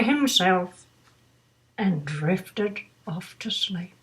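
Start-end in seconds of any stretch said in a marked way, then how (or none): 1.8-3.9 hushed storytime whisper. A narrator's reading. Reader whispering as Bernard drifts off to sleep. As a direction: breathe it out as he falls asleep. Narrating falling asleep.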